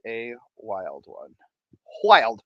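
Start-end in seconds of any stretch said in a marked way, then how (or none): 2.0-2.4 punchy emphasis. Acted. Repeats word for effect.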